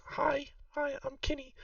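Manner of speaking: put-on high, soft little girl greeting; small child voice. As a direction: pitch it way up like a shy kid. GM voices shy child Kinney.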